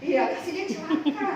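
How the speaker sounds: sarcastic repeat rising at the end; mocking echo. Preacher mocks the spiteful prayer to his congregation, sarcastic. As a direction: toss it back with a mocking lilt. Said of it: "Nggak boleh kayak gitu".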